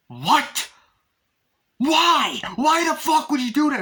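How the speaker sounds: high, loud furious shout; incredulous yell. Retold or imitated. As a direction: scream it in disbelief. Retold movie character freaking out.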